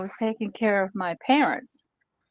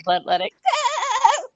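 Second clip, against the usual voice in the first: much higher, louder, slow bleating scream of let it go; screaming goat; 5 dB louder, slower than usual. Retold or imitated. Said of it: "a goat screaming".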